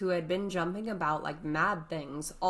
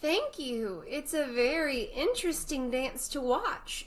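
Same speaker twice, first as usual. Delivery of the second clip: polite Alice; 9 semitones higher than usual. Acted.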